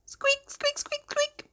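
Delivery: tiny very high squeaks; mouse squeaks. Acted. Reader voicing tiny mice, soft high squeaks. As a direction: squeak softly like little mice.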